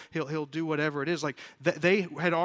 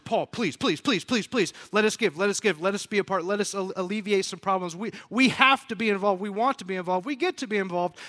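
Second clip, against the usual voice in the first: louder, higher eager pleading; begging earnestly; 4 semitones higher, 6 dB louder, faster than usual. Acted. Church members pleading with Paul to let them give, begging earnestly. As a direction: plead in a rapid-fire tumble, piling up the pleases. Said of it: "they were begging earnest".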